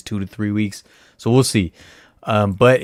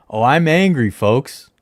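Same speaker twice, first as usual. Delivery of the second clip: louder, higher mock outrage to the viewers; over-the-top pretend anger; hammed-up anger; 5 semitones higher, 6 dB louder than usual. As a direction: ham up fake fury for the audience.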